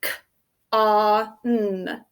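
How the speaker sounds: "K, ah, n" as isolated phonemes; phonics-teacher "K, ah, n" with gaps between; single sounds. Shown as a demonstration. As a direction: sound out "K, ah, n" one by one, holding ah and n. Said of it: "what do you hear?".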